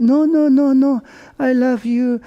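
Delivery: high, loud, hurried pleading; pleading protest. Retold or imitated. Son pleads with his angry wife, high and loud. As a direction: rush to reassure her, voice jumping up.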